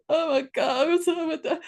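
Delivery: high, excited oh my God; touched excitement. Retold or imitated. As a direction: squeal with joy at the news. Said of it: "And I'm like".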